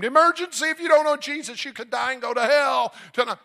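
high, full-force emergency scream; screamed warning. Retold or imitated. Preacher warning two hundred diners in Pizza Hut, screamed. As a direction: scream it like a frantic alarm. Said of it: "and screamed".

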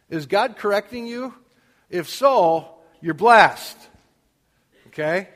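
loud, driving preacher delivery; full-force preaching. Acted. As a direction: hammer the point home to the congregation.

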